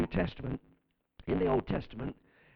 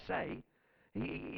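ordinary talk

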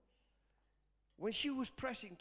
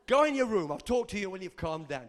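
louder, brisk parental order; parent to kids; 7 dB louder than usual. Retold or imitated. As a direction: send the kids off firmly. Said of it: "I do that to my kids".

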